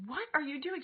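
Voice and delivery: high-pitched incredulous mom question; retold scolding question. Retold confronting of her daughter.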